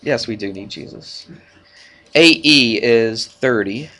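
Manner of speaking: ordinary talk, nothing marked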